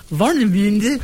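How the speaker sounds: hammed-up German accent on Warnemünde; mock German pronunciation. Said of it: "your German accent is almost undetectable".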